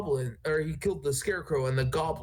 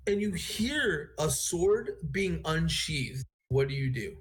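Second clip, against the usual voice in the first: usual speaking voice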